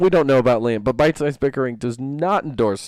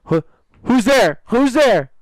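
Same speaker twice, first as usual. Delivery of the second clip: startled, louder and higher; scared; 11 semitones higher, 6 dB louder, slower than usual. Retold or imitated. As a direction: call out nervously into the dark. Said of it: "he's like".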